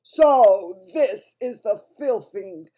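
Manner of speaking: loud, slow, menacing Bloodbottler bellow; booming giant. A narrator's reading. Bloodbottler sneers at the BFG's food, booming loudly. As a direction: boom it out like a huge brute giant. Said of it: "boomed the blood bottler".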